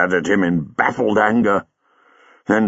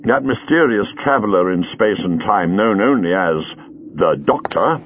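usual speaking voice

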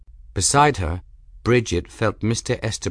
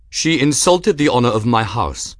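louder, haughty accusation; lofty; 3 semitones higher, 5 dB louder than usual. A narrator's reading. Duelist stating his grievance to the official, lofty. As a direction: declare the grievance loftily. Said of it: "Reggie said loftily".

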